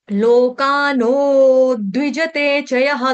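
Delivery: drawn-out Sanskrit teaching chant; measured, sustained recitation for pupils; slow verse recitation. Shown as a demonstration. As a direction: recite syllable by syllable with long vowels.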